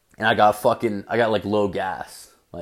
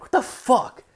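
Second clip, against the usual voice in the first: squeaky high outraged disbelief; retold disbelief; 13 semitones higher than usual. At the gas pump when both cards fail, high disbelief. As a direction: shoot the voice way up in disbelief.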